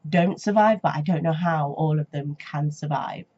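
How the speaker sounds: normal talking voice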